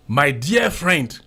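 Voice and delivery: ordinary talk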